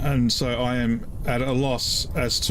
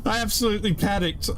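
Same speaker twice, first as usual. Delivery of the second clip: higher, lively admission with stress on absolutely; amused admission; 8 semitones higher, faster than usual. Real, not acted. Player marvelling at his own panic, raised amused exclamation. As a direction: toss it off with amused disbelief.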